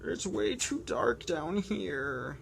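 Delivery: whiny penguin, calls for fish high and loud; grumble, then yell for the fish; complaining penguin. Acted. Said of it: "Doing my complaining voice hurts".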